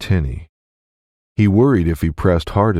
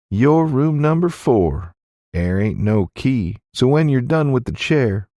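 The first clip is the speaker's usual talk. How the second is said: teasing innkeeper woman; 4 semitones higher than usual. Acted. Innkeeper explains room and town.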